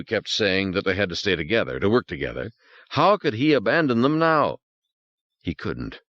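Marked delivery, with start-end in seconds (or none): none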